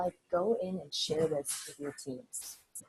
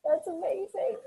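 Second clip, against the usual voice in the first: laughing, much higher; 13 semitones higher than usual. Real, not acted. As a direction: burst out laughing at it. Laughing reaction to goal.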